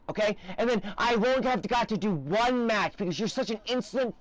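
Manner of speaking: furious game rage. Acted. Screaming at matchmaking in a game.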